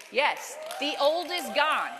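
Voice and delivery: loud, slow preacher shout. Real, not acted. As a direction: proclaim it out loud. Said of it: "You can shout".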